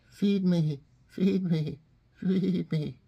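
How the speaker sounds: a hint of a pleading dove; soft-spoken dove. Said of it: "a dove would be a little more soft-spoken".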